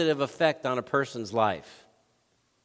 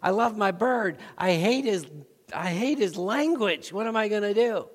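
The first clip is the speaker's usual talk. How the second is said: a lightly voiced fed-up man, higher and whiny; mock the exasperated owner, pitched up; frustrated parrot owner; 7 semitones higher than usual. Parrot owner moaning about his swearing bird, lightly voiced higher whine. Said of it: "And he's like".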